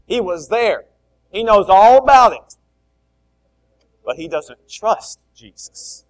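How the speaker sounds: loud, driving preaching; loud fired-up sermon; full-force preaching. Acted. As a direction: press the point hard from the pulpit.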